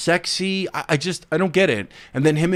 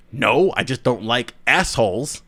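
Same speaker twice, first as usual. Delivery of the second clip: ordinary talk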